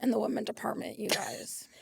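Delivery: fading into a whisper; mock self-pity; trailing whisper. Real, not acted. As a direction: let it sink to a whisper. Sad confession to friends.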